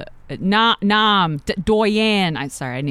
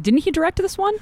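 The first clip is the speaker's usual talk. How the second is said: high voice; 12 semitones higher than usual. Acted. Playful aside about producer.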